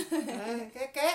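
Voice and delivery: normal talking voice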